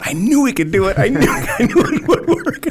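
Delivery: gleeful triumph. Retold or imitated. Brad jumping up celebrating, high and laughing. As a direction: crow with delight, laughing through it. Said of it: "Brad's jumping up and going".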